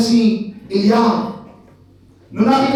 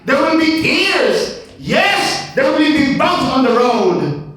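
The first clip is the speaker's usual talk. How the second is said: preaching build; 5 semitones higher, much slower than usual. Acted.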